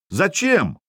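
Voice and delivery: high indignant outcry, falling at the end; возмущённый вопль. A narrator's reading. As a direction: protest like a let-down crew, without a full shout. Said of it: "раздался дружный возмущённый вопль". (возмущённо воскликнуть)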